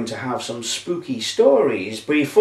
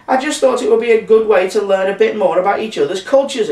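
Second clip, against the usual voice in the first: high-pitched indignant explanation; exclaiming; 8 semitones higher, 3 dB louder than usual. A narrator's reading. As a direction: exclaim it high, defending yourself. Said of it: "exclaimed Cleo".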